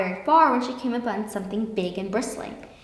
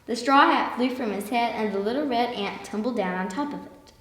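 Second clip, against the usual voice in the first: ordinary delivery, nothing marked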